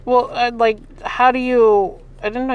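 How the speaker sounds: loud stammering disbelief; disbelieving. Real, not acted. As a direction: sputter in disbelief. Stunned disbelief.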